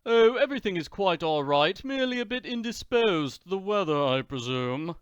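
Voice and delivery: a haughty count brushing it off; suave Count. Acted.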